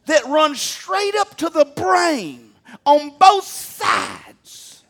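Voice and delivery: loud, slow fiery preaching. Acted. Preaching at full force.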